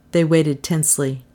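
plain reading voice

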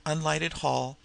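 plain reading voice

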